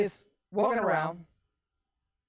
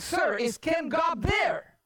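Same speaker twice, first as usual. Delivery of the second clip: higher, slow exasperated operator; 6 semitones higher, slower than usual. Retold or imitated. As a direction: press the question with mock exasperation. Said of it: "she started getting exasperated with him".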